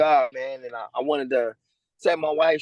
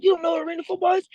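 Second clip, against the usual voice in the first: much higher, fast mock disbelief; incredulous coach; 12 semitones higher, faster than usual. Coach amazed the player never heard of arena football, high and fast. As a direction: pitch it way up like you can't believe it. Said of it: "He's like".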